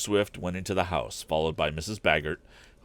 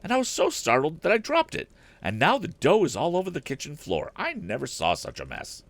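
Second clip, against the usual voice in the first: a higher-pitched fussing woman, lightly voiced; a worried housekeeper pleading with the inventor; housekeeper's voice; 10 semitones higher than usual. A narrator's reading. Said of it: "went on Missus Baggert".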